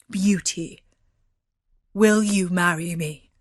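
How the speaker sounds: measured, heavy beast proposal; gruff beast. A narrator's reading. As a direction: ask solemnly in the beast's voice. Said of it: "he asked her as before".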